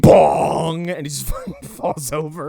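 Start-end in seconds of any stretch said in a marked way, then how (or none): 0.0-0.9 loud yelled impact noise; shouted bong. Retold or imitated. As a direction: shout the hit like a cartoon sound effect. Impact noise in a retold fight.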